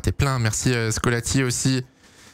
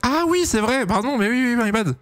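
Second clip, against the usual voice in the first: sudden realisation; 10 semitones higher than usual. Real, not acted. Realises chat corrected him.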